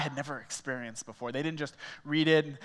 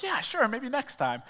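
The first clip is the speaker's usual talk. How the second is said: higher, slower, forced politeness; drawn-out fake agreement; stalling politely to dodge the invite; awkward polite agreement; 6 semitones higher, slower than usual. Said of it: "we're being polite".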